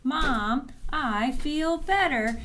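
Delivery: put-on a boy gladly telling mom; excited boy. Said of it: "This is what he starts".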